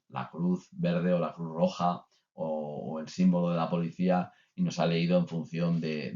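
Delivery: ordinary talk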